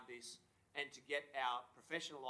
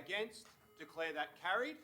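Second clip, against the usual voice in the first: shouting over fire alarm; 4 semitones higher, 5 dB louder than usual. Real, not acted.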